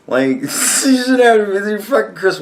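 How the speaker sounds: high, laughing through the words. Real, not acted. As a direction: break into a laugh mid-sentence. Laughing at the moderator's plight.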